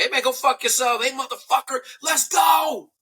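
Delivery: pumping up the team, a hyped yell; hyped football shout. Retold or imitated. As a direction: fire up the team like a coach. Imitating football locker-room talk.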